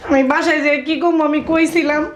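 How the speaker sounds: high, loud crying complaint; tearful. Acted. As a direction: plead through tears. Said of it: "কান্নাকাটি কেন করতেছো".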